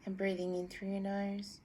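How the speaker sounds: calm meditation guide; guided meditation. Acted. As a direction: guide them gently into breathing.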